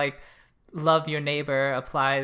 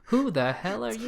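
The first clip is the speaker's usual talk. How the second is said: higher-pitched affronted question; mock indignation; 8 semitones higher than usual. Cohost voicing the family at the intruder, mock indignation. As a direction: act out startled, affronted challenge. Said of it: "It's like".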